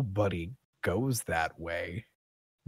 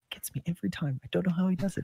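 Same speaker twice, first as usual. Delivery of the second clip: soft, higher-pitched marvelling voice; game character Alice; 8 semitones higher, 4 dB quieter, faster than usual. Acted. Worker marvelling at a phenomenal curtsy, soft high voice. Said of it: "And she's just like".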